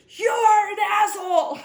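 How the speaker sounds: high, loud, slow mock outraged yell; refined lady yells at fisherman over the hidden holes, hammed-up shout; imitating her outburst. Throw it at him like a shrieking accusation. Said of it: "she turned to me and said".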